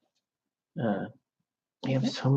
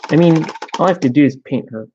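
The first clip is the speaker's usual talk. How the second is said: ordinary delivery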